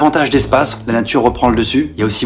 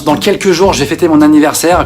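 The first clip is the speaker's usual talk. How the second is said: ordinary talk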